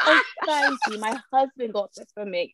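put-on British accent, gushing over the ring; posh British accent. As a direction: play a proud British wife flashing her ring. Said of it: "Why does [name] got a British accent?".

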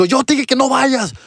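high scolding mother voice; imitating a mom scolding her son. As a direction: scold the kid like an upset mom.

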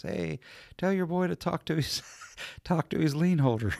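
high-pitched retelling broken by a laugh; quoting the dispatcher's punchline, laughing; laughing through it. Retold or imitated. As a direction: giggle mid-line while quoting the dispatcher. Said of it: "She goes".

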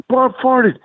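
high, gleeful tattling; giddy announcement. Retold or imitated. Ref runs over to tell Warlord, high and gleeful. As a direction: spread the gossip like a kid. Ref telling everyone, laughing.

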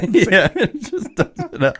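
real laughter through the words; laughing. Real, not acted. Storyteller recalling the friend dumping out the boot, laughing.

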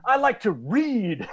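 high, slow prissy voice; drawn-out mock innocent line; mock prim reader. Mocking a pilgrim reading porn.

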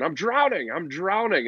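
high, hammed-up cry of a drowning man; mock drowning cry. Pundit mocking Nick Foles as a drowning swimmer, hammed-up. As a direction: wail it like a man going under, for laughs. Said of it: "He's like".

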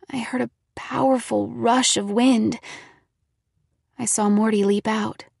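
ordinary delivery, nothing marked

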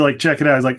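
ordinary delivery

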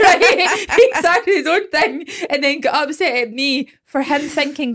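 talking through laughter; giggling out the story; laughing. Real, not acted. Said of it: "laughing while telling".

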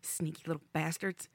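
ordinary talk

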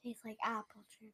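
flat whispered verdict; whispering. Acted. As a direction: whisper it close to the mic.

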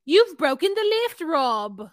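loud, hammed-up British accent slipping; wandering fake British accent. Still playing the girlfriend, fake British accent. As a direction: overdo the London accent. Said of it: "I'm in South Africa again".

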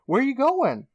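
put-on high, frightened girl asking; high squeaky voice of a scared girl; scared Stacy. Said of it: "Stacy's like".

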